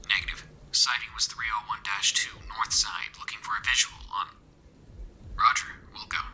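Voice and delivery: soft whispered radio chatter; like a distant radio transmission; faded military radio. A narrator's reading. Soldiers over a crackling radio, stern and regimented. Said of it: "stern and regimented voice faded in".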